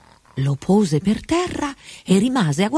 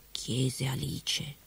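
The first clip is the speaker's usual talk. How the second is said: ordinary delivery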